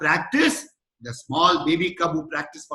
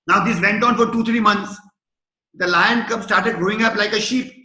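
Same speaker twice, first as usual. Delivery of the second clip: usual speaking voice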